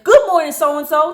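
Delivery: high, loud mock-cheery greeting; sarcastic. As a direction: greet them with pointed fake cheer. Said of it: "I said it with sarcasm".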